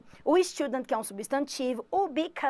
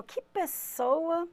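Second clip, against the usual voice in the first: slow, softer, suspicious Que pessoa; doubtful tone; 4 semitones higher, 5 dB quieter, much slower than usual. Shown as a demonstration. Teacher shows students a worrying Que pessoa, slow and soft. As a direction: let Que pessoa sound like a veiled insult. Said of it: "se fala assim, ó".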